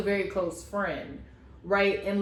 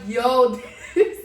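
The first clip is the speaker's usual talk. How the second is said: louder, laughing exclaim; laughing disbelief; 3 semitones higher, 6 dB louder than usual. Retold or imitated. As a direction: react to the drama in giddy shock. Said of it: "I was just like".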